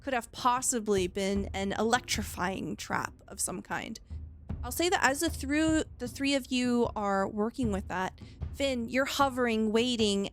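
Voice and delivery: ordinary delivery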